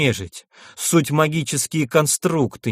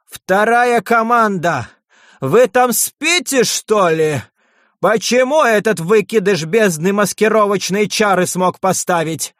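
hoarse yell; 8 semitones higher, 4 dB louder than usual. Acted. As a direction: bellow hoarsely at lazy underlings. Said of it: "хрипло заорал".

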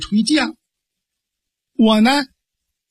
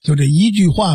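usual speaking voice

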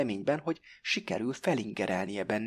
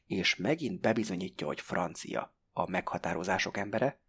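ordinary delivery, nothing marked

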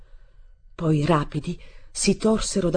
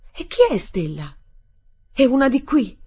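loud, high, ferocious questioning; fierce, menacing; 8 semitones higher, 7 dB louder than usual. A narrator's reading. Stepmother questioning the boy about a rival, fierce. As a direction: demand an answer with jealous menace. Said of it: "una ferocia minacciosa e barbara".

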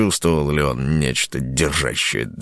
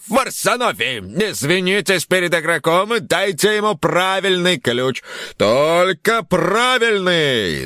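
host barks a stern order; 13 semitones higher than usual. Acted. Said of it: "грозно рявкнул на хлыща".

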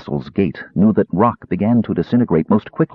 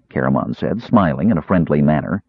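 plain reading voice

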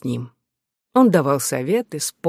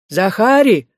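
raised, higher call toward the door; 5 semitones higher, 5 dB louder, slower than usual. A narrator's reading. Old man summoning his servant Захари from the door, calling out. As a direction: call out for a servant, a little louder. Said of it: "крикнул он в сторону двери".

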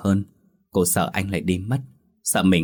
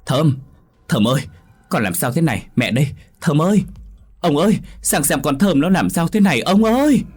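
high, loud frantic wail; mother shrieking for help; wailing calls; 8 semitones higher, 5 dB louder than usual. A narrator's reading. Mother finds daughter fainted, wailing for husband. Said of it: "mẹ mình đang gào khóc gọi tên cô".

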